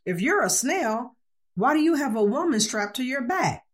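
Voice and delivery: a touch of bafflement, pitch lifted; mildly confused. A narrator's reading. Party host questioning a costumed guest, mildly confused. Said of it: "The host is thoroughly confused".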